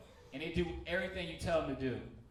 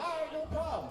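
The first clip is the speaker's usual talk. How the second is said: eager agreement; 11 semitones higher than usual. Retold or imitated. Eager yes to gangster cousin.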